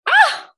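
short, very high-pitched cry of excitement; high delighted yelp; excited squeal. Retold or imitated. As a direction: squeal at the sudden success. Relives the boxes selling out.

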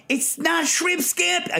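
high, drawn-out mock outburst; exaggerated, slow-stretched protest in a high voice; outraged restaurateur. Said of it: "He doesn't talk like this".

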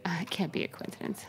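a whispered conspiratorial aside. Real, not acted. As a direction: breathe it like a secret.

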